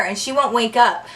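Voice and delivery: ordinary delivery, nothing marked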